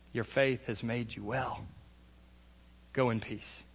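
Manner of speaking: soft, slow and gentle; tender blessing. Acted. Jesus gently sending the healed woman away in peace, softly. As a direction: speak it like a quiet blessing.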